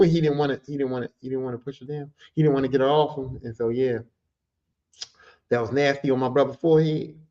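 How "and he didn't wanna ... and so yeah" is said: normal talking voice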